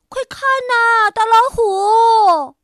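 loud, high proud call. Acted. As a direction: shout it out, bursting with pride. Mouse proudly calls the tiger. (兴奋得意地大声呼喊)

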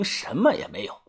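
usual speaking voice

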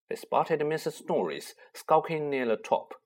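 ordinary delivery, nothing marked

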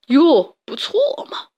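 high sneering "哟，不错嘛", voice jumping way up; weird mocking voice. A narrator's reading. Tiger brushing off mouse's castle, strange mocking voice. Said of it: "只是怪声怪气地说".

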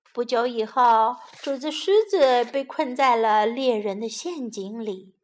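usual speaking voice

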